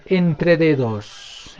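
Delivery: Entre dedos drawn out very slowly; very slow dictation. Shown as a demonstration. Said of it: "¿cómo se dice en Aymara?". (Entre dedos, muy despacio, dictando)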